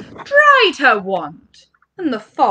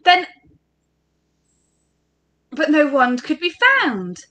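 plain reading voice

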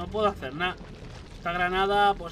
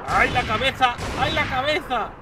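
loud, urgent exclamation; alarmed cry; 4 semitones higher, 6 dB louder than usual. Real, not acted. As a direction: cry out in alarm, twice over. Alarm during game fight.

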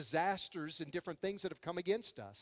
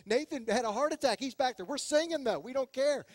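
excited churchgoers; 7 semitones higher than usual. Retold or imitated. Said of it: "Other people would start to think".

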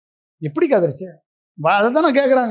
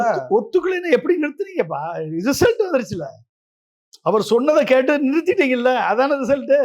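higher-pitched, insistent challenge; heated arguing; 4 semitones higher than usual. Real, not acted. Host pressing the guest on why they stopped, heated arguing. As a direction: press the point in a raised pitch, cutting in.

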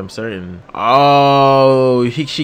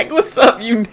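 much higher mock greeting; imitating the character; 9 semitones higher than usual. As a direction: toss it off like a cocky newcomer. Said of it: "He like".

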